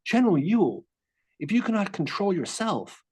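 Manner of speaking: composed acted rebuke in a Virginian voice; calm Virginian voice. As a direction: reprove calmly as a Virginian commander. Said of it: "calmly say in that Virginian voice".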